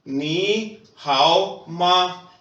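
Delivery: नी हाओ माँ बहुत धीरे, शब्द-शब्द अलग; छात्रों के दोहराने के लिए धीमा अभ्यास; slow drill. Shown as a demonstration. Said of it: "अगर मैं आपसे कहता हूँ". (शिक्षक का बहुत धीमा दोहराव)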